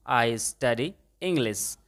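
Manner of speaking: very slow, each word separate; slow model sentence. Shown as a demonstration. Teacher models the answer for students, very slowly. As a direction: lay it out slowly for the class. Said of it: "अंग्रेजी पढ़ता हूँ".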